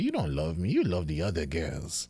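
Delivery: low, quiet hurt mother's accusation; a soft guilt-tripping mom; mother sulking at her son, low and quiet; mom's complaint. Retold or imitated. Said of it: "She looked at me and said".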